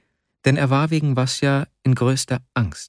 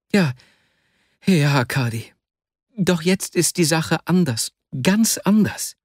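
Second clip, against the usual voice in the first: lightly voiced, higher-pitched anxious friend; a hint of agitation, pitched up; troubled Vasja; 6 semitones higher than usual. A narrator's reading. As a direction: protest with mild distress. Vasja protests, distressed, to his friend.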